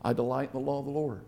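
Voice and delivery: low, soft, quick repeated mutter; muttering to oneself. Shown as a demonstration. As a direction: recite it under your breath, low and quiet. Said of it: "You just mutter that to yourself".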